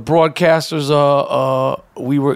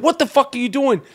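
raised yell; 6 semitones higher than usual. Retold or imitated. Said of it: "And then I said".

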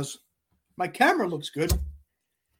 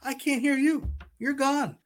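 calling out; 11 semitones higher, slower than usual. Real, not acted. Calling through broken audio.

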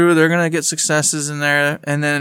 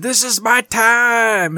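slow, higher, grand proclamation; proud declaration; 6 semitones higher, much slower than usual. Pretend. Host voices a cosplayer's post as a slow, grand announcement. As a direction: draw it out like a triumphant entrance. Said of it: "She said".

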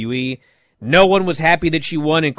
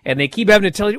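normal talking voice

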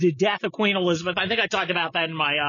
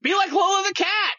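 rally the listeners; rallying shout; 11 semitones higher than usual. Real, not acted. Host urges listeners, a high shout.

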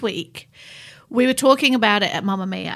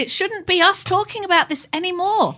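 anguished plea; 9 semitones higher than usual. Real, not acted. Passionate plea, voice rising high.

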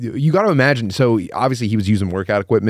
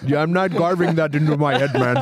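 put-on comic Indian accent, pitched up; 4 semitones higher than usual. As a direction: play a prisoner with an Indian accent.

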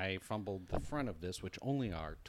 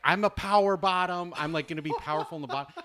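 imitating contestant; 7 semitones higher, 10 dB louder, faster than usual. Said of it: "And he's like".

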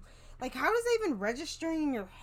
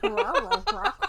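slow, high Scooby-Doo "Railroad tracks"; mushy cartoon dog voice; 9 semitones higher, 7 dB louder, slower than usual. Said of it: "Are you okay, Scooby?".

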